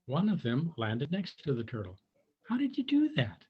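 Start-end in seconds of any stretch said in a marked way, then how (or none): 2.5-3.4 high amazed little voice; firefly voice. A narrator's reading. Firefly asks Tudley, high and amazed. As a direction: ask in a high wondering squeak. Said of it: "he asked".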